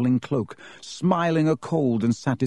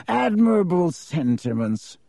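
mock admiration; 6 semitones higher than usual. A narrator's reading. Malfoy's insincere compliment.

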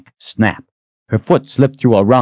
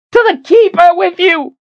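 screaming; 5 dB louder than usual. Acted. Still screaming at the spirits.

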